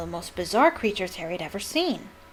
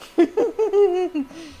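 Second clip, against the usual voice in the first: mocking laugh; 10 semitones higher than usual. A narrator's reading. Malfoy mocking the lesson.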